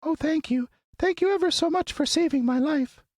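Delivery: very high, tiny squeaky voice; squeaky little mouse. Acted. Field mouse thanking the Woodman, squeaky tiny voice. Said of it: "it said in a squeaky little voice".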